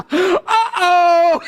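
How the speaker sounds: high, loud, teasing; gleeful mocking laugh. Real, not acted. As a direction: tease the devil with a laugh. Mock warning to devil, laughing.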